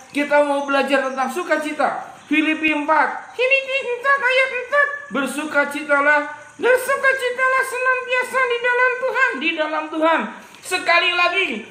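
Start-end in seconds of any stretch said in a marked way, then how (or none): 3.3-4.9 high puppet voice reading the verse; lightly voiced child puppet. Puppet recites the verse to the kids, high. 6.6-9.3 put-on high child puppet reciting. Puppet repeats the teacher's verse, high voice.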